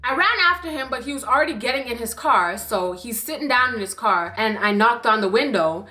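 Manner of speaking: ordinary delivery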